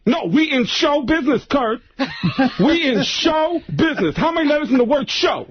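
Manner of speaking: high, slow, emphatic mock lecture; pissed-off Tracy Morgan. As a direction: spell it out slowly in a high, exasperated put-on voice. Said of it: "Tracy got pissed and pulled the car over".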